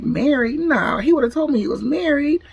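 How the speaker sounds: high-pitched incredulous denial; disbelieving. Retold or imitated. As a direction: act out disbelief, pitch swooping up. Disbelief at the coworker's news.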